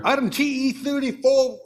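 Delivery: British darts announcer, loud and high; a hammed-up British darts caller calling the item. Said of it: "in an exaggerated and excited British accent".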